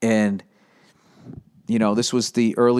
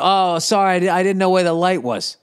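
mock apology; 6 semitones higher than usual. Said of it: "he goes".

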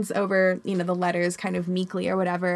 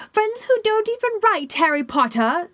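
high squeaky sly house-elf; a sneaky little elf teasing; 12 semitones higher, 4 dB louder than usual. Acted. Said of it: "said Dobby slyly".

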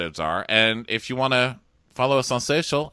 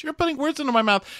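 very high, fast mock whine; mocking an offended cast member, high and fast; whiny high mimic; faster than usual. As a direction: squeak it out, offended. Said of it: "And she goes".

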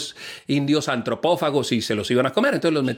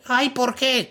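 whiny high voice; 9 semitones higher, much slower than usual. Acted. Pablo whining.

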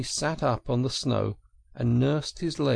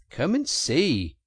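higher, louder, drawn-out invitation; delighted; 6 semitones higher, 5 dB louder, slower than usual. A narrator's reading. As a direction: call a friend over with delight. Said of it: "said the delighted Rat".

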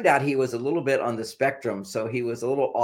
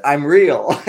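joyful I'm real; 3 semitones higher, 11 dB louder than usual. Retold or imitated. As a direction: burst out like a toy come alive. Velveteen Rabbit joy, acted.

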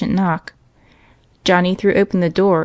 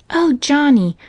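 put-on high child's voice; little girl; 8 semitones higher, 3 dB louder, slower than usual. A narrator's reading. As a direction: address little brother in a high girlish voice. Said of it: "she continued".

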